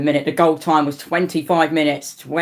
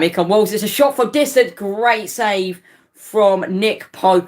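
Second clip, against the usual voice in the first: live commentary call; 6 semitones higher, slower than usual. Real, not acted. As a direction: call the save as it happens. Live play-by-play of a shot.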